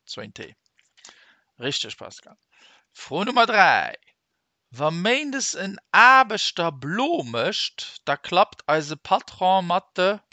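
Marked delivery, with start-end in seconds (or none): 2.9-10.2 loud question read. Shown as a demonstration. Teacher reading the quiz question to the class, loud.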